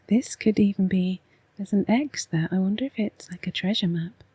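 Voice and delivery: normal talking voice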